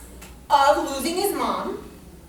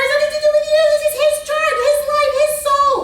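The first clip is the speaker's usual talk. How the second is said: high and loud, hammering the point home; emphatic retold insistence; 12 semitones higher, 8 dB louder, much faster than usual. Telling the guilty mother it is the boy's own chart, loud and insistent. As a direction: insist it firmly on each phrase. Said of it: "And I said to her".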